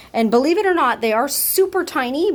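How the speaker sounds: ordinary delivery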